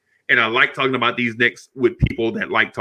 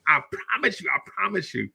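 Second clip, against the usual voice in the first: sheepish promise; 6 semitones higher, 8 dB quieter than usual. Retold or imitated. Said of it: "and I'm like".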